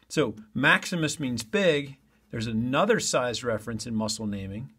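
ordinary delivery

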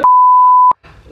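real, loud high-pitched protest; alarmed shout. Real, not acted. Startled partner protests at the wife's outburst, alarmed shout. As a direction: cry out in alarm, drawing out the last word.

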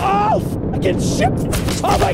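loud, high startled curse; startled shout. Real, not acted. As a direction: yelp it as the blast hits. Sudden explosion.

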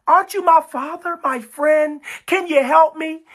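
high-pitched begging for help; acted sinner's plea; pleading. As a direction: beg a father, voice pushed up. Acting out sinner begging God.

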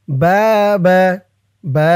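loud chanted "বা" with a held vowel; one-alif stretch. Shown as a demonstration. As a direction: project and draw out "বা". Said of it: "এক আলিফ টান". ("বা" জোরে এক আলিফ টেনে)

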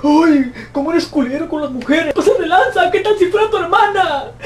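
high, whiny mock voice of scolding critics; squeaky sarcastic imitation of a critic. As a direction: parody the offended people, laughing through it. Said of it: "personas que me reclamaron".

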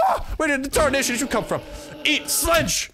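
high, frantic game-fight holler; startled battle yell. Real, not acted. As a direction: yell in surprise and strike back.

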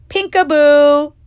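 loud, slow, drawn-out shout; little brother bursting in with a yell. Acted. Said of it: "Peter ran in and yelled".

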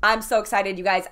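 usual speaking voice

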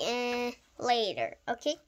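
plain reading voice

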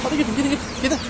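high coaxing shoo; herding call. Real, not acted. Herding animals. (hayvanları yüksek tonla sürerek)

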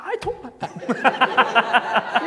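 high, slow, laughing insistence; laughing high protest. Retold or imitated. Student defending his slang to classmates, high and laughing. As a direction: stretch it out through laughter. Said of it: "And I was like".

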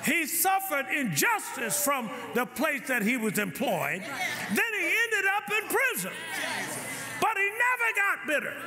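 rising, slowed preaching climax; preaching build. Acted. Pastor building to a climax for the church, slow and high. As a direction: lift the church toward forgiveness.

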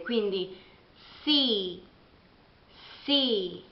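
C said as see; English C. Shown as a demonstration. Teacher showing English letter C. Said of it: "si pronuncia con la S".

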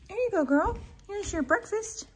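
high sing-song voice for the dog; talking to the pup in a high, sweet voice; high pet-talk voice. Real, not acted.

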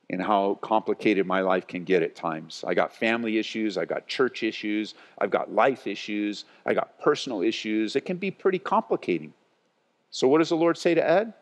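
usual speaking voice

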